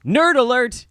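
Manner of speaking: high, loud joking call-out; mock alarm. Host teasing over nerdy physics talk, mock alarm. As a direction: sound a playful alert.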